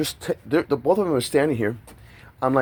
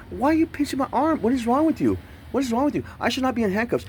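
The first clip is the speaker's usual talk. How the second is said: much higher, strained, indignant protest at the officer; retold outraged protest; 13 semitones higher than usual. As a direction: replay the outrage in a high, tight voice.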